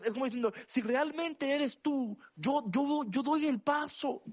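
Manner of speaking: high-pitched, urgent preacher delivery; impassioned preaching. Real, not acted. Preacher urging the listeners to believe God, impassioned.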